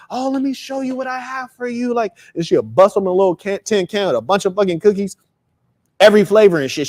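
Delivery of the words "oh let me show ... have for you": much higher, soft, sweet; excited aunt offering treats. Retold or imitated. Aunt showing the boy her cookie tin, gushing. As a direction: tempt the child with a surprise.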